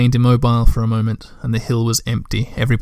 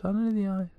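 high and much softer, dying away; the echo drifting off into the distance; fading echo; 8 semitones higher, 8 dB quieter than usual. A narrator's reading. The echo repeats Tutiki again, fading far off.

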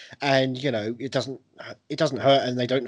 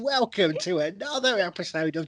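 mock Welsh host, bursting in; Rob Brydon Welsh impression; 5 semitones higher, slower than usual. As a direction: Welsh accent impression, slowing down. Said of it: "He's like".

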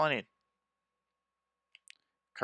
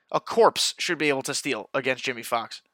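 usual speaking voice

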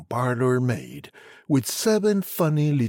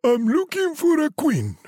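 high fussy king voice; a silly high-voiced king; 4 dB louder than usual. A narrator's reading. King's letter voiced.

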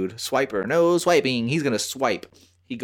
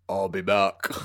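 Terminator; 4 semitones lower, slower than usual. Retold or imitated. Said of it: "I pulled the Terminator".

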